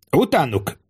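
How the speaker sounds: high-pitched, restrained battle cry; narrated shout. A narrator's reading. Tribune sends riders after townsmen, acted shout. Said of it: "kiáltotta Quintilius".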